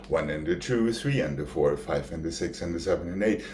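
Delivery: quick rhythmic count speeding up. Shown as a demonstration. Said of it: "This is getting a little bit faster".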